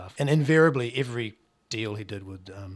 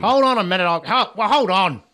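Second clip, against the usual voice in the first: put-on loud, much higher shouting old man; deaf old bloke; 10 semitones higher, 14 dB louder than usual. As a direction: yell it like a half-deaf grandad who can't hear. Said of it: "someone who's slightly deaf".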